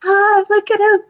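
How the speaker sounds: high, mocking coo. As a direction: tease him in a sing-song. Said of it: "I'm like".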